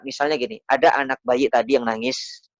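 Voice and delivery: ordinary talk, nothing marked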